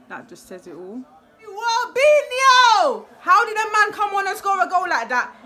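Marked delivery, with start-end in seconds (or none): 1.4-5.3 loud, high drawn-out name call; real excited hollering; hyped shout. Real, not acted. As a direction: yell his name in delight. Hyped by a great goal.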